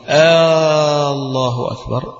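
آه الله أكبر with the opening hamza of الله stretched long, like a question; slow drawn-out آه before الله أكبر; lengthened hamza. Shown as a demonstration. Teacher shows a wrong takbir, hamza stretched into a question. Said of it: "لو إن إنسان وهو يقول".

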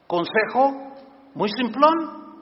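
ordinary talk, nothing marked

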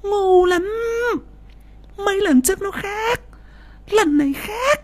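a mock roar in a high nagging woman's voice; wife scolding her husband, high and sharp. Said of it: "Mụ Trang gầm lên".

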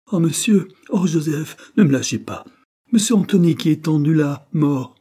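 high, loud panicked cry; terrified cries. A narrator's reading. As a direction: shriek it out in horror at the body. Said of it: "en poussant des cris perçants".

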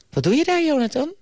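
very high-pitched puzzled teacher voice; acted high-voiced surprise; surprised teacher. A narrator's reading. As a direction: ask it high, as a startled schoolteacher. Teacher spots pupil on floor.

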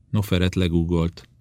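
usual speaking voice

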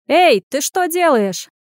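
high, loud, drawn-out call; shout from below. A narrator's reading. Man below spots Anya on the ledge, shouting. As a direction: call up sharply to someone overhead. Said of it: "раздался крик внизу".

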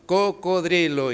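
"Cocodrilo" slowly, with a clear d in -drilo; corrective pronunciation. Acted. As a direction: correct the pupil with a drawn-out "Cocodrilo". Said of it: "¿no?". ("Cocodrilo" corregido despacio, con d clara)

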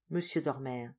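ordinary delivery

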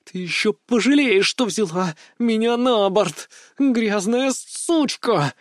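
slow, high snarling vampire threat; growling. A narrator's reading. Vampire assistant, chained, snarls at the captain: slow furious growl. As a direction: drag out the curse in a furious growl. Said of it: "рычала Мори".

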